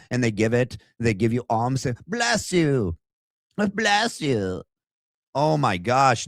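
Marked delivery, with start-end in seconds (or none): 2.1-4.6 high, pleading mock beggar; beggar blessing. Vlogger mimicking widow as beggar, high pleading. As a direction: bless them like a grateful beggar, pitched up. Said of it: "like a beggar".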